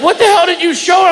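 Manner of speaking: high, fast mock-shouted wife; high fast yell at husband; exasperated wife. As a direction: snap it like a startled wife. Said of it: "my wife's like".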